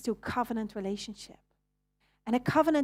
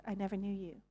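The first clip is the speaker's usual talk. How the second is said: soft, grave; quiet verdict; 11 dB quieter, faster than usual. Acted. Jesus answering the pleading crowd, soft and grave. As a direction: let the words fall quietly, final.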